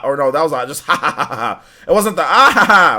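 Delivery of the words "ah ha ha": loud mock villain cackle; Mandark laugh. Streamer showing chat the Mandark laugh, loud mock cackle. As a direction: belt out a cartoon villain's laugh. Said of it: "the Mandark laugh".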